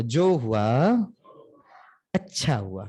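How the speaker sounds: smiling. Shown as a demonstration. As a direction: smile through the whole phrase जो हुआ अच्छा हुआ. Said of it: "थोड़ा मुस्कुरा कर बोलो". (मुस्कुराते हुए, धीमे स्वर में)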